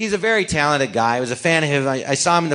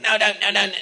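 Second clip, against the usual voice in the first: higher, rapid-fire string of refusals; mock protest; 5 semitones higher, faster than usual. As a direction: play a flustered protest. Retold protest after a kiss.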